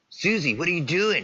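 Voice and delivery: high-pitched imitation of a startled boyfriend; startled confrontation. Boyfriend spots Susie on a random video chat, startled confrontation. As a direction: act out catching her, voice jumping up.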